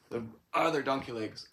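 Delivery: ordinary delivery, nothing marked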